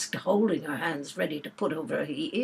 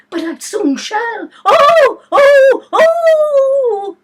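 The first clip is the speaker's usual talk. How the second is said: very high, loud wailing oh; screaming; 15 dB louder, much slower than usual. Acted. Queen screaming in advance of pain. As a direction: wail it shrill and loud.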